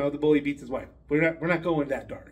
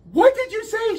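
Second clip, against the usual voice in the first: teacher's demand; 5 dB louder than usual. Acted. Teacher demands the word again.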